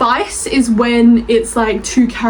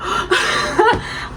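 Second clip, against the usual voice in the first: giggle; 10 semitones higher, 3 dB quieter than usual. Real, not acted. Laugh after the squealed title.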